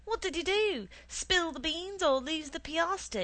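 high, loud telling-off, settling by the end; shouting scolding. A narrator's reading. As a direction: scold a naughty child loudly. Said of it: "my mother shouted at me".

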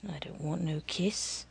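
soft, low, sulky mumble; sullen quiet refusal. A narrator's reading. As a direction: refuse it under your breath. Scared boy refusing.

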